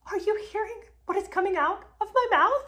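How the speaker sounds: telling viewers how outrageous this is, in high disbelief; incredulous. Real, not acted.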